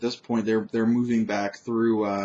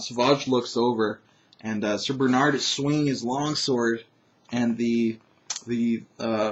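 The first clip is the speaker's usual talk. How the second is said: ordinary talk, nothing marked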